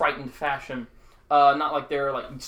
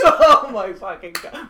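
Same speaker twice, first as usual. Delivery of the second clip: loud, laughing disbelief; 7 semitones higher, 10 dB louder than usual. Real, not acted. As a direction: laugh through the words. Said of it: "reacting to the battle cry".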